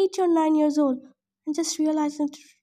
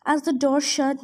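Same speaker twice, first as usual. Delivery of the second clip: usual speaking voice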